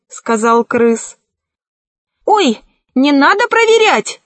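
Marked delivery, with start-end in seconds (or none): 2.3-4.2 high-pitched panicky protest; alarmed cry. A narrator's reading. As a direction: beg them off in a high voice. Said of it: "закричал колдун".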